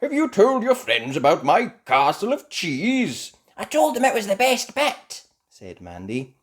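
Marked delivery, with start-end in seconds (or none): 0.0-3.3 high, loud proud question; a hint of a proud grandpa; lightly voiced Grampy Rabbit. 3.6-5.3 very high, loud reply; Mandy. Acted. Mandy answers.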